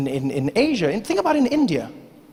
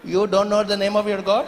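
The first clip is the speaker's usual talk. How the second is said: put-on Indian accent. Indian farmer incredulous.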